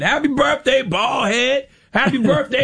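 high, slow, drawn-out call of a nickname; casual call-out. Retold or imitated. Radio host mocking how people call out to a stranger, high and drawn out. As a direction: sing out the nickname across the room. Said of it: "We will reduce people to whatever".

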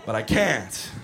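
normal talking voice

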